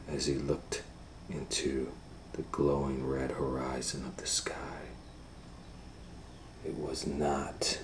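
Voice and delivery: soft low whisper; whispered ending. A narrator's reading. Doctor Varmint whispering the moral at the end.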